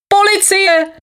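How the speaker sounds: very high, shrieked call for police; yelling. A narrator's reading. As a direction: cry out for help high in the voice. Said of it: "křičel synovec". (vysoký křik o pomoc)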